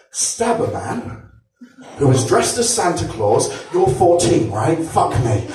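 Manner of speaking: usual speaking voice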